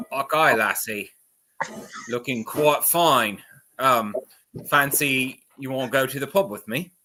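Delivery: a rough Scottish brogue put on for a flirt; Scottish accent. Dwarf flirting with the lead werewolf in a Scottish accent. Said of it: "Nick hates all of my accents".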